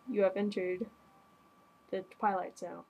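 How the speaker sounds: low, ominous announcer voice; Twilight Zone narrator. Retold or imitated. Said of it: "I can reenact the guy's voice".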